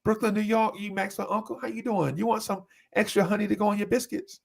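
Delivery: high, sugary sweet customer-service voice; extra friendly cashier. Retold or imitated. To a regular customer, high sweet cashier voice. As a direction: play the overly friendly register lady. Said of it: "She be like".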